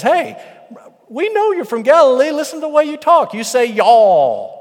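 very high, loud heckling; shouted accusation. Acted. Bystander calls out Peter's Galilean way of talking, shouting accusingly. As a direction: holler it out, dragging the last word long.

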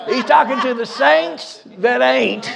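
repeating the line slowly through a laugh. Real, not acted. Preacher repeating his joke to the church, laughing. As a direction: chuckle as you say it again.

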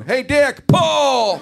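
loud high Bush-style call, hammed up; Bush impression. Comic voicing the president calling to the vice president, loud mock shout.

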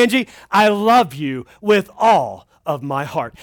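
slow, low acted vow of love; tender declaration. Preacher playing devoted husband to his wife, tender. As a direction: draw out a loving vow, low. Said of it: "to my wife and I say".

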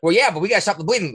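usual speaking voice

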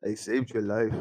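put-on low, soft African accent. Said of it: "you gotta do it in the African accent".

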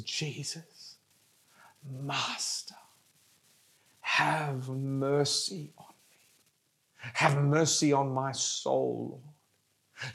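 slow, low and breathed, dropping to a whisper; hushed pleading prayer. Acted. Preacher praying as the listeners should for mercy, near-whispered plea. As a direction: beg softly, leaving long pauses.

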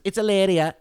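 Hilaria with no H and a tapped r; Spanish pronunciation. Retold or imitated. Podcaster imitates Hilaria introducing herself, Spanish pronunciation. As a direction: mimic her saying Hilaria the Spanish way. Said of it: "but she says".

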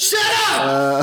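high-pitched mock shout; mock outburst. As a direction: cut them off with a squealed pretend yell. Mock outburst when contradicted.

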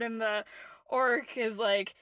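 ordinary talk, nothing marked

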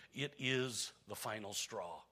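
ordinary talk

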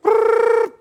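high buzzing ring imitation; phone ring. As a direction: mimic an old phone ringing, high and loud. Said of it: "It rang and went".

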